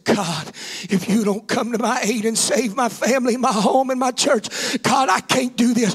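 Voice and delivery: loud, high cry of prayer over music; preaching at full force. Real, not acted. Preacher on his face in prayer, crying out loud. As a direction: cry out to God with everything.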